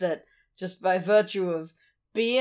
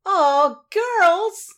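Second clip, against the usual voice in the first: put-on high, eager golly-gee kid voice; hammy old-timey child actor, climbing to a squeal; wholesome 1950s kid; 7 semitones higher, slower than usual. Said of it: "it's a whole lot of".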